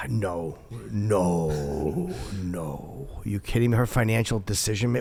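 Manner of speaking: low, hushed refusal; near whisper. Real, not acted. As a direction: drop your voice low, as if she might overhear. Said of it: "why am I whispering?".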